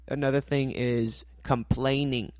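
ordinary talk

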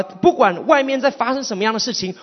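ordinary talk

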